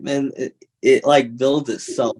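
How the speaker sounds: usual speaking voice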